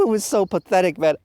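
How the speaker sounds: ordinary talk, nothing marked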